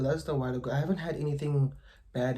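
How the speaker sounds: ordinary delivery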